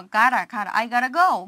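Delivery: I gotta go said the American way, T softened; flapped T. Shown as a demonstration. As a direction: gotta with the T melted into a quick d. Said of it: "T 발음 굉장히 연화시키는".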